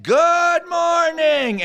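high, loud, slow drawn-out greeting; cheery wake-up shout. Retold or imitated. Drugged counselor waking the skunk-filled cabin, shouting cheerfully. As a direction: belt it out like it's sunrise. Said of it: "And he said".

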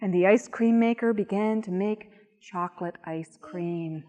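ordinary delivery, nothing marked

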